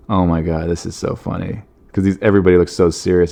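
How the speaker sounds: usual speaking voice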